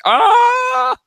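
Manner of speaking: long, high, loud mock scream; hammed-up death scream; imitated scream. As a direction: scream out a drawn-out "ah". Imitating a character's scream in show.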